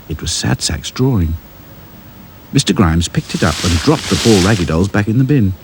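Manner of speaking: plain reading voice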